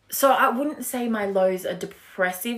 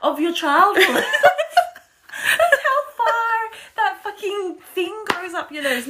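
laughing; 13 semitones higher, 3 dB louder than usual. Real, not acted. Laughing about nose swab.

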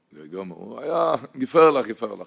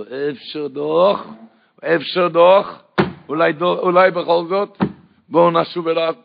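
mock cry; 5 semitones higher, 6 dB louder, slower than usual. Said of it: "הקדוש ברוך הוא צועק לו".

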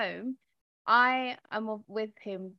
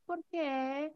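put-on child's why; 7 semitones higher than usual. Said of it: "he's like".

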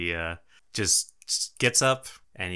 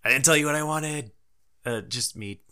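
hurried call; 7 semitones higher than usual. Acted. Goblin calls after servant.

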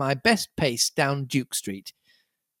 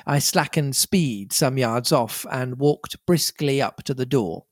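ordinary delivery

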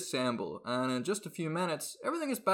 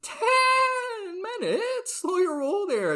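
outraged shout; 4 dB louder, much slower than usual. Acted.

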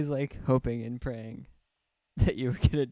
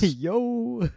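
calling out; 11 semitones higher, 6 dB louder than usual. Pretend. Audience member calling out.

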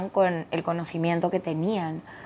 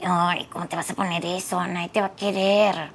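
friendly monster voice. Shown as a demonstration. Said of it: "estoy tratando de hacer mi voz de monstruo".

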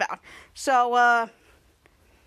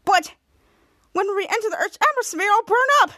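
higher and louder, rising into a panicky wail; panicky protest; 6 semitones higher, 4 dB louder than usual. Retold or imitated. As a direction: panic more as you realize you'll burn. Catman panics about burning up.

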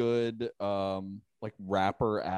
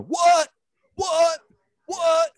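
high, loud barked rapper ad lib; 8 dB louder, much slower than usual. Retold or imitated. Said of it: "him just going".

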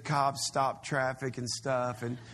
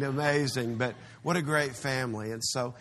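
ordinary delivery

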